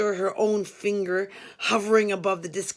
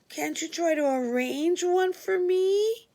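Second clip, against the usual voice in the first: much higher, pleading whiny voice; plaintive Frankie; 9 semitones higher than usual. Retold or imitated. Inmate Frankie pleading with the narrator to arrange him a call. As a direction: beg for the favor pitifully. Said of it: "he asked plaintively".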